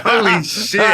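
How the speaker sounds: high, loud starstruck exclamation; stunned disbelief. Retold or imitated. Fan hearing a star's voicemail, stunned disbelief. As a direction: blurt it out in shock. Reacting to Harrison Ford's voicemail.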